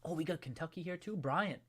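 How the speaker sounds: ordinary talk, nothing marked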